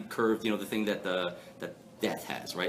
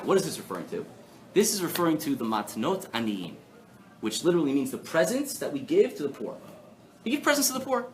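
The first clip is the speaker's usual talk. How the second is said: usual speaking voice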